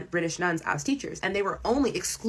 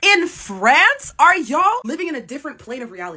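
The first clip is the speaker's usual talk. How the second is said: much higher and louder rising question; incredulous disbelief; 7 semitones higher, 7 dB louder than usual. Real, not acted. Mocking French race denial, loud incredulous disbelief. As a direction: ask it in outraged disbelief.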